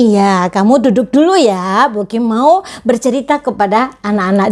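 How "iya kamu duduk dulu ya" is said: ordinary talk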